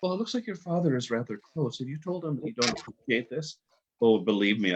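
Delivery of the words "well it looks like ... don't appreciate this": gentle advisor voice. Retold or imitated. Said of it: "they said".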